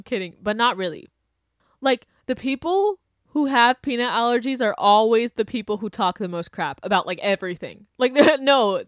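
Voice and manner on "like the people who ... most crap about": usual speaking voice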